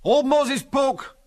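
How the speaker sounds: high, loud, drawn-out shout; stretched-out high holler. A narrator's reading. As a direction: call it out across a distance. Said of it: "shouted Angus".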